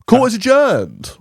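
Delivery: high, drawn-out courtroom proclamation; mock judge. Mock verdict closing the discussion.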